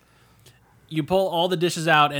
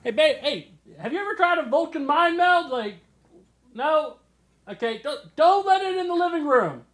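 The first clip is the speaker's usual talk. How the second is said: calling out; 11 semitones higher than usual. Acted. Calling to partner in next room.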